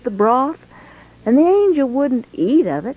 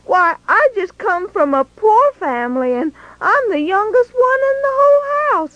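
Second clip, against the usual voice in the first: higher, stammering, disbelieving protest; flustered Gideon; 7 semitones higher than usual. Acted. Gideon telling the angel he is too small, higher and flustered. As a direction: sputter like a timid youngest son.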